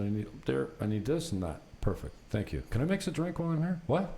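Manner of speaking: normal talking voice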